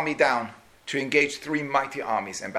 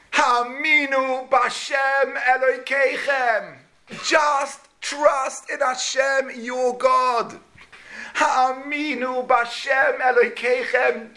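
impassioned proclamation; 8 semitones higher, much slower than usual. Acted. King declaring trust in God to his soldiers, impassioned. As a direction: proclaim faith to the army with full force. King's four-word rallying drasha.

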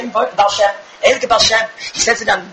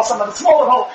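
normal talking voice

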